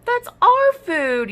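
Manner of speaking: high, loud and drawn-out protest; a dino protesting at the snack thief; indignant yell. Acted. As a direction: shout it slowly, outraged. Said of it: "yelled Apatosaur".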